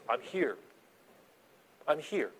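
quiet, gentle promise of presence; soft reassurance. Pretend. Pastor voicing God softly reassuring the grieving. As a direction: hush it like a comforter at your side.

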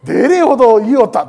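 loud Okayama dialect phrase. Retold or imitated. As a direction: put on the Okayama dialect with gusto. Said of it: "岡山弁になんとかなれようと". (大きな声で岡山弁をまねて)

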